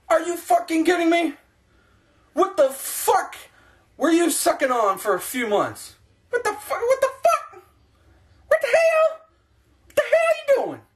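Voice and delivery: ranting at the Buccaneers owners, disbelief climbing high; outraged rant. Real, not acted. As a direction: rant at the owners, pitch rising each time.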